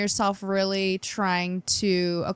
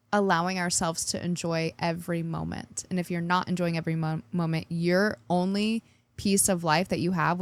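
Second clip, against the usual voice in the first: ordinary talk, nothing marked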